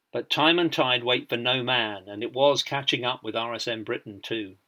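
usual speaking voice

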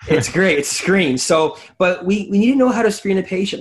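rising to an eager point; excited. Real, not acted. Excited about screening patients.

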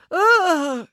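high, hammed-up groan; old lady groan. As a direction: groan as if in pain. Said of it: "groaned Dame Bronwyn".

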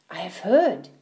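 ordinary delivery, nothing marked

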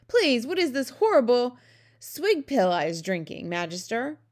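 loud, high plea at the start, easing off; appalled cry. A narrator's reading. Giant asking the Queen about his drink, crying out. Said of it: "he cried".

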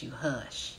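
"hush" said soft and breathy, low and hushed; Southern coach telling students to go quiet, "hush" whispered low. Shown as a demonstration. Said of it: "we say hush".

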